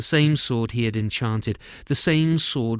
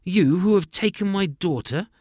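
higher, louder indignant cry; king's outcry; 8 semitones higher, 5 dB louder than usual. Acted. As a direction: fling it back at him. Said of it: "cried the king".

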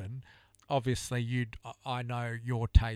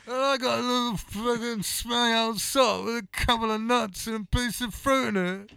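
high, loud Brummie rock-star whine; [name] impression; 11 semitones higher, 5 dB louder than usual. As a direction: do Ozzy moaning about his sock. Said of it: "what was it [name] say".

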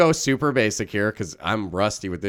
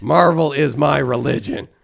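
louder, slower fan proclaiming; loud declaration; 6 semitones higher, 7 dB louder, slower than usual. Acted. Die-hard Marvel fan proclaims his faith to the worker, loud and slow. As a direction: proclaim it like a creed.

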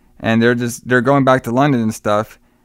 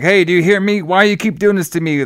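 high, frantic retold plea; going crazy; 7 semitones higher than usual. Retelling Carson pleading with the vampire inside, worked up and frantic. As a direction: demand answers in a raised, worked-up voice. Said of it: "going crazy".

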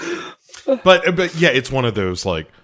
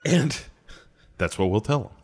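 ordinary talk

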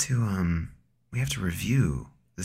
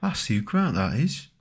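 higher, hammed-up British bobby; mock British cop; 4 semitones higher, 4 dB louder, slower than usual. Mock British cop spotting Hugh Grant. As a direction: do a cheeky English copper. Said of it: "But it was in America, though".

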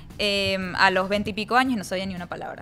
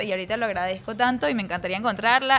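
ordinary talk

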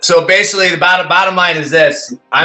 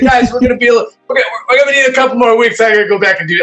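higher and fast, rushing the news; flustered announcement; 8 semitones higher, faster than usual. Retold or imitated. Singer telling bandmates he needs more weeks, flustered. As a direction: blurt it out half laughing.

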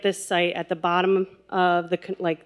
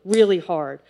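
plain reading voice

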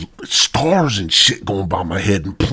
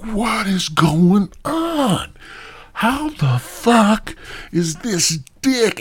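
high, drawn-out disbelief; bewildered outcry; 11 semitones higher, much slower than usual. Acted. Man trapped in the tunnel reacts to the ordeal, bewildered outcry. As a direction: stretch out each word in appalled bafflement. Acted shock at painful ordeal.

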